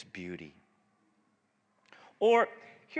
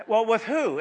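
alarmed parent; 6 semitones higher, 8 dB louder than usual. Retold or imitated. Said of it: "say you're a parent".

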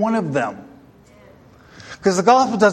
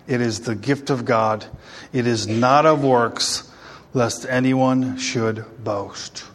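slow, low, measured verse reading; scripture reading; 6 semitones lower, slower than usual. Real, not acted. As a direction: recite the verse low, weighing each phrase. Reads a Bible verse aloud.

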